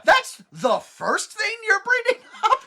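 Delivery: baffled, laughing disbelief; incredulous outburst. Retold or imitated. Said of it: "I had to go".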